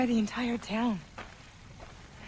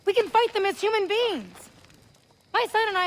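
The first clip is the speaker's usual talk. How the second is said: higher, forceful rallying cry; impassioned protest; 9 semitones higher than usual. Acted. Mother argues the village can fight, impassioned and higher. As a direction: fight back against her reasoning.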